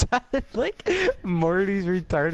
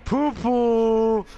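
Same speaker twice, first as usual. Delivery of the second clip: silly shout; 9 semitones higher, 5 dB louder than usual. Acted. Goofy shout to make Tyler laugh.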